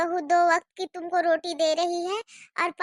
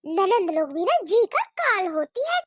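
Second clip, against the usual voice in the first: normal talking voice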